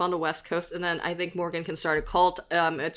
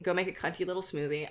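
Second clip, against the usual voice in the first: ordinary delivery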